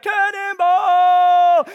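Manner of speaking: long, drawn-out, high battle yell; cannonball scream. Retold or imitated. Pastor re-yelling his cannonball as he jumps in, slow and loud. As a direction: scream it while leaping into the pool. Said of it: "I even screamed it out".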